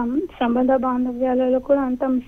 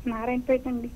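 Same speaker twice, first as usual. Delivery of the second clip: softer, timid answer; speaking softly; 10 dB quieter than usual. Real, not acted. Caller answering the host's assistant: soft and timid. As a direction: answer barely above a murmur. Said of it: "ఎందుకు అంత చిన్నగా మాట్లాడావు".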